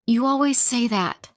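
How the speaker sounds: loud, high accusing shout. A narrator's reading. Wife shouting at husband who has no time. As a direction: shout it right at him. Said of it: "I shout".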